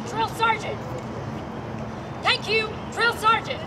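normal talking voice

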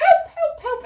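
high, loud cries of help; shouting for help. Acted. Girl fleeing the wolf, loud cries for help. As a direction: call out as if in danger. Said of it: "heard her shouting".

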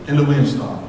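ordinary delivery

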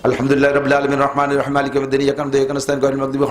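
Fatiha gabbled fast without a pause; rushed in one breath. Shown as a demonstration. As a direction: race through the verses in one breath. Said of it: "এক নিঃশ্বাসে মারিয়ে দিয়েছেন". (এক নিঃশ্বাসে দ্রুত তাড়াহুড়ো করে পড়া)

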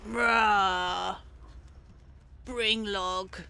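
low roared monster command; potato creature. Acted. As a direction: roar it out like a lumbering creature.

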